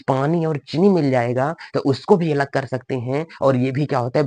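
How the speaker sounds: normal talking voice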